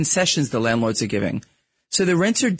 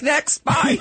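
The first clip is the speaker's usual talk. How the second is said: high, laughing dismissal; mock curt boss with a laugh; imitating boss; 10 semitones higher than usual. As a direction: brush them off like an impatient boss. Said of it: "he's like".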